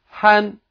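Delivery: "han" held high and level; first tone. Shown as a demonstration. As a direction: say "han" on a high, even pitch. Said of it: "first tone".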